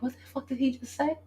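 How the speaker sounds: hushed, stunned disbelief at his words; can't believe what he said. Retold or imitated. Retelling her ex's surprise plan, hushed and stunned.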